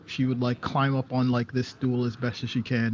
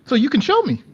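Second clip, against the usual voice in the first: put-on high, loud childlike question; curious little kid; 14 semitones higher, 9 dB louder than usual. Kid pointing at a door, high curious voice. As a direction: pipe up like a nosy small child.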